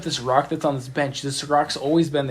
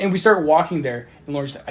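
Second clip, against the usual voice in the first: normal talking voice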